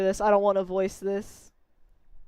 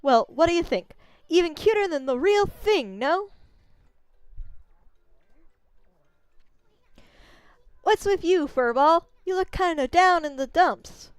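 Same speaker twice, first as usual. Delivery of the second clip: higher, cocky little bug voice; a brash tiny braggart; Issun voice; 7 semitones higher, 4 dB louder than usual. Acted. Tiny bug artist bragging to the wolf, higher and cocky.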